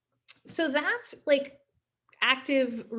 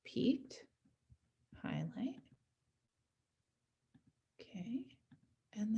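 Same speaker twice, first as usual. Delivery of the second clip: whispered to self; 14 dB quieter, slower than usual. Real, not acted. Muttering to self while clicking.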